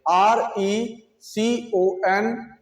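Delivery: reconsider spelled very slowly, letter by letter; slow spelling. Shown as a demonstration. Teacher slowly spelling reconsider for students. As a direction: drag out each letter of reconsider. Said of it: "क्या बोलेंगे".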